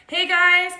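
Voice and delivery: ordinary delivery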